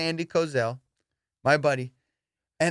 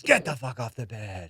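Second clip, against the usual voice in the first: menacing order; 6 semitones lower, 3 dB quieter than usual. Retold or imitated. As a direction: imitate a crazed friend giving orders, pitched down. Retold drugged friend ordering friends off bed.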